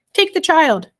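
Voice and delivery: calling after him. A narrator's reading. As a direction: call out to someone leaving. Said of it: "called Homily after him".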